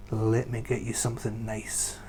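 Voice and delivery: a barman's secretive whisper; hushed whisper. Retold or imitated. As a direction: whisper it like sharing a treat. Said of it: "when Peter says".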